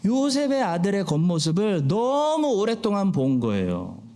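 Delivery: usual speaking voice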